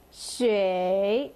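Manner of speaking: "shui" dipping low, then rising; third tone. Shown as a demonstration. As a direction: draw "shui" down and back up. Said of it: "so down and up".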